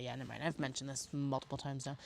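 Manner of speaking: ordinary talk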